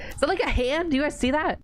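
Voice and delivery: high startled question; alarmed surprise. Real, not acted. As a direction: point it out in shock. Spotting something in image.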